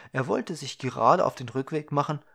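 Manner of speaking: plain reading voice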